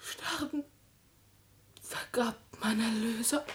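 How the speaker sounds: faint whispered last breath; barely audible dying whisper. Acted. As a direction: let the words fade out as she dies. Dying Luise's last words.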